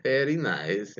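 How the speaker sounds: put-on brief Borat accent through a chuckle. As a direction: drop into a light mock Borat accent. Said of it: "in his best Borat impression".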